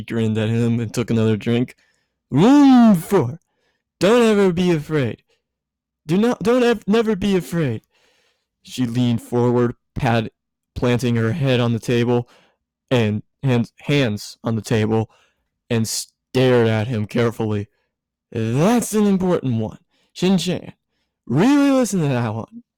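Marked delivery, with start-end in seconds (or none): 2.3-7.8 high, giddy tipsy woman, voice pitched up; tipsy Misato. A narrator's reading. Tipsy Misato lecturing Shinji, high and giddy. 18.3-22.6 high, giddy tipsy woman, voice pitched up; tipsy Misato. A narrator's reading. Tipsy Misato lecturing Shinji, high and giddy.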